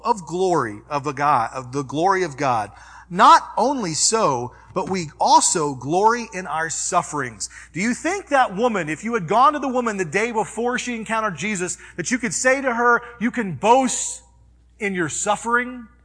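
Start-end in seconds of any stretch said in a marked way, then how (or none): 3.1-7.5 raised, emphatic preacher voice; preaching. Acted. Pastor preaching to the congregation, raised and emphatic. 7.7-15.9 raised, emphatic preacher voice; preaching. Acted. Pastor preaching to the congregation, raised and emphatic.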